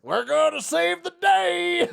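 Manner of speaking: very high, drawn-out cartoon hero cry; mock-heroic battle cry, stretched out; gung-ho hero. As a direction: charge in like an over-eager cartoon hero. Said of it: "And Aragorn's like".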